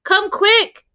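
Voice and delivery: put-on high, urgent child's plea; urgent boy. A narrator's reading. Breathless boy urges the doctor, high pitched. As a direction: beg her to hurry. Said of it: "He was out of breath".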